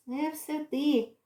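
ordinary delivery, nothing marked

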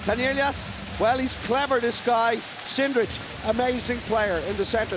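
usual speaking voice

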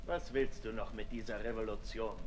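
quiet taunt. Acted.

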